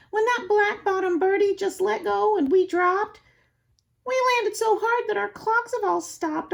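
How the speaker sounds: a tiny voice shaken by disaster; distressed tiny mayor. Acted.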